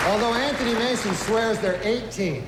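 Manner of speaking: loud, brash stand-up host working the crowd; awards host monologue. Acted. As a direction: play to the room like an awards show host. Stand-up host monologue, louder, announcer.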